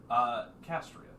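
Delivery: usual speaking voice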